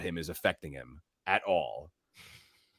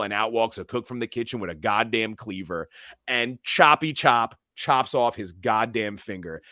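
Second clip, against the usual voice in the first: ordinary delivery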